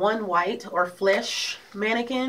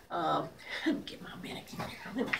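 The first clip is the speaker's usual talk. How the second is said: whispered aside with a laugh; 3 semitones lower, 12 dB quieter than usual. Real, not acted. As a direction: whisper it under your breath. Aside while fetching mannequin.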